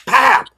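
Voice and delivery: a sharp, high slap sound, said out loud; high loud slap noise; mock slap noise. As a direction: smack the word like a slap. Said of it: "and said".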